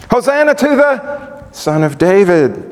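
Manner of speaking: high-pitched acclaiming call; crowd's cry. Retold or imitated. Crowds greeting Jesus on the donkey, crying out. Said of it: "what the crowds cried".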